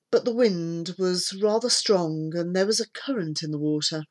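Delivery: ordinary delivery, nothing marked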